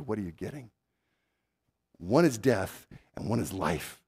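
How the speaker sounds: ordinary talk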